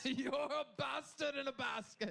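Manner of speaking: high mock shout. Host shouting repeated joke.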